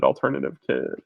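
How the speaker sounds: ordinary talk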